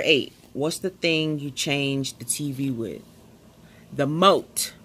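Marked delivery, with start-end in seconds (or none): 3.9-4.7 "moat" for remote, first syllable swallowed; emphatic one-syllable "moat"; remote run together. Real, not acted. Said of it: "I be saying it so fast".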